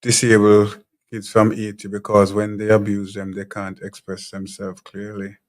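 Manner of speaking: normal talking voice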